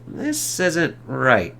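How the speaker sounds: very slow, drawn-out words; slow puzzled Reed. Retold or imitated. Reed sees his machines turn on the family, slow dismay. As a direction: stretch it out in dismay. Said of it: "as Reed's like".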